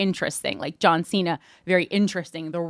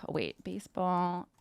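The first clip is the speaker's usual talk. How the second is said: very soft, absent-minded murmur; muttering to self; 3 semitones lower, 12 dB quieter than usual. Real, not acted. Host typing in a search, quietly muttering. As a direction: say it under your breath while typing.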